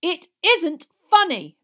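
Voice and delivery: slow, high, very loud rebuke; angry shout. A narrator's reading. Father rebukes his laughing family, angry shout. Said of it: "Mister Weasley shouted".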